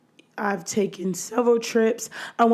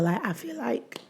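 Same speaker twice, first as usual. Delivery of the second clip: whispering; 3 semitones higher, 8 dB quieter than usual. Real, not acted. Whispered aside.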